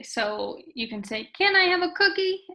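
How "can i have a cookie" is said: "Can I have a cookie?" high and loud, questioning through "cookie"; emphasized question. Shown as a demonstration. Teacher showing how to read "Can I have a cookie?", emphatically questioning. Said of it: "make it really emphasize that it's a question".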